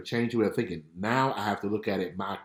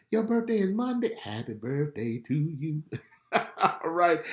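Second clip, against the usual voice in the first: cheerful; 8 semitones higher than usual. Acted. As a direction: celebrate the viewer's birthday. Sings birthday to viewer.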